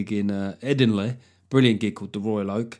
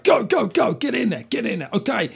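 high, urgent whispered-style barked commands; mock military raid urgency; urgent commando orders; 11 semitones higher, 3 dB louder than usual. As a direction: hiss orders like a special ops leader storming in. Acting special ops sex raid.

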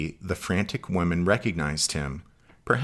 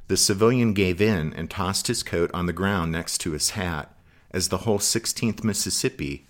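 usual speaking voice

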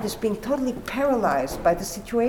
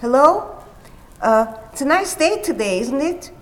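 chatty neighbor; 6 semitones higher, 7 dB louder than usual. Retold or imitated. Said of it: "she said to the neighbor".

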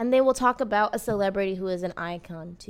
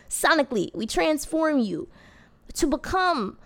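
grand intro voice; 5 semitones higher than usual. Acted. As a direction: proclaim it like a show opener. Repeats intro more grandly.

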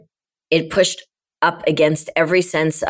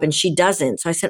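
ordinary talk, nothing marked

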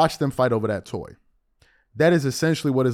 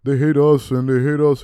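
mildly hammed-up outcry of complaint; mock hollering; 5 dB louder than usual. Host imitating fans complaining about foreign players, mock hollering. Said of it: "y'all be up here hollering about".

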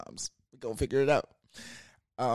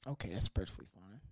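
whisper; 5 semitones lower, 10 dB quieter, faster than usual. Real, not acted. Whispered aside about friend.

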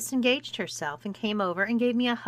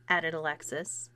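usual speaking voice

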